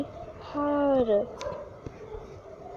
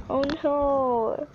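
usual speaking voice

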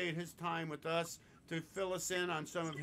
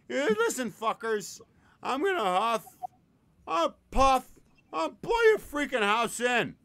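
big bad wolf; 7 semitones higher, 11 dB louder than usual. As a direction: bellow it like a cartoon villain wolf. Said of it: "And he says".

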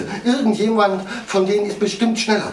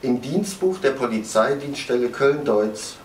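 low, quiet, detached narration; sober report; 9 semitones lower, 5 dB quieter than usual. A narrator's reading. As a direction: read the facts coolly, like a case file. Closing report read by narrator.

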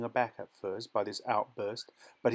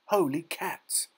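high, drawn-out exclamation; amazed cry; 7 semitones higher, slower than usual. A narrator's reading. Boy spots a magnificent swan on her nest, amazed cry. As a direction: cry out in wonder, pitched up. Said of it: "cried Raymond".

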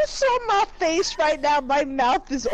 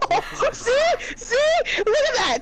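high-pitched comic indignation; mock outrage; 7 semitones higher than usual. Real, not acted. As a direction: rant in a raised pitch, playfully scandalized. Mock outrage at the game character.

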